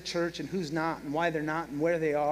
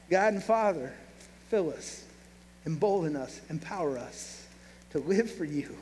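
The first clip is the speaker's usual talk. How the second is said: prayer. Acted. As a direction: pray gently, trailing off at the amen. Closing prayer.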